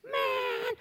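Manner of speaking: very high, drawn-out exasperated sigh word; exasperated man. Retold or imitated. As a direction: stretch it up high in disbelief. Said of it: "Listen to what Jesus says".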